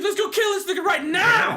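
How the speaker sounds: high, laughing mock threat; retold angry outburst. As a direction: ham up the fury while laughing. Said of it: "I was like".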